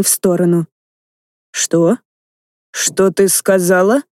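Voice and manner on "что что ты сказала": rasping, stunned and slow; hoarse. Acted. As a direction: croak it out in shock. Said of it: "прохрипел Велиор".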